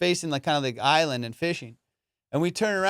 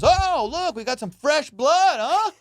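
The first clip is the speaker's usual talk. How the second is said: mocking officer; 11 semitones higher, 5 dB louder, slower than usual. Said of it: "And he goes".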